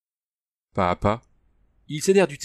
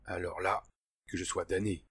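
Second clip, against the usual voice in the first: much softer, echoed thought; inner thought; 3 semitones lower, 11 dB quieter than usual. A narrator's reading. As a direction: think it quietly to yourself. Andrew's thought, echoing Hans. (pensée intérieure très douce)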